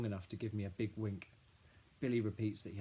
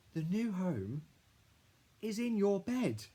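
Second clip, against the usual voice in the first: high, louder, slowing on the reveal; proud little boy; 11 semitones higher, 6 dB louder, slower than usual. A narrator's reading. Billy revealing the snail is in her bed, high proud kid voice. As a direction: deliver the reveal like a small boy proud of himself.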